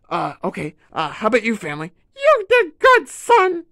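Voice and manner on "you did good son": very high, loud praise; high squeaky voice. Acted. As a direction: squeak it proudly. Family member praises.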